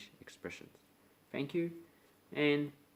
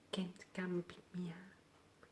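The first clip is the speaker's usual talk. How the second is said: high, whispered and soft; whispered request; 7 semitones higher, 10 dB quieter than usual. Retold or imitated. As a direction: let the favour trail into a whisper. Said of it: "someone goes".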